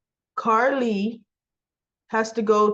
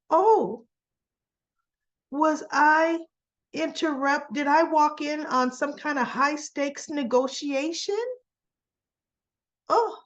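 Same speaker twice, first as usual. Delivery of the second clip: higher-pitched mock innocent questioning; attempted Holly impression; 6 semitones higher than usual. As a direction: imitate another woman's voice, pitched up and halting. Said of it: "I can't even do her accent".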